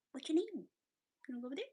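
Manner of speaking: usual speaking voice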